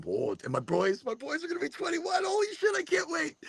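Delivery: high-pitched giddy anticipation; excited. Real, not acted. Dad of boys nearly twenty-one, excited. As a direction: let the voice shoot up with excitement.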